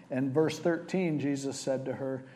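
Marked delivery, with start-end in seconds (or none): none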